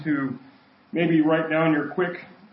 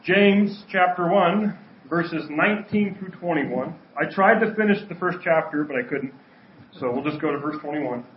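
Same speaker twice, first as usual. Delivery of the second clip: ordinary talk, nothing marked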